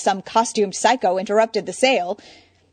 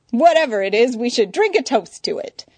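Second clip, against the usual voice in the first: foppish; 4 semitones higher, 6 dB louder than usual. Acted. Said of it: "fop in his manner".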